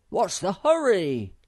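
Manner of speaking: loud, high call; Moomintroll. A narrator's reading. Moomintroll calls to Sniff climbing the cleft, shouting. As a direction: shout it down, falling at the end. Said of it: "called Moomintroll".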